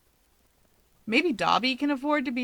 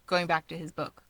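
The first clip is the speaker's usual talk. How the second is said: ordinary delivery, nothing marked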